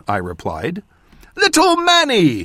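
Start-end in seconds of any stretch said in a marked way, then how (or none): 1.3-2.4 put-on high, loud delighted old watchman; exclaiming. A narrator's reading. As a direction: cry out in happy recognition. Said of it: "he exclaimed".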